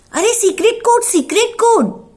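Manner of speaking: very high, loud eager cry; excited reminder. Acted. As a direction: kid suddenly remembers the secret code. Kid suddenly remembers code. (उत्साह से याद दिलाता बच्चा)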